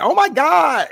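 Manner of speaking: very high mock outcry; imitated yell. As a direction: mimic his high-pitched complaint yell. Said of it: "he's yelling out".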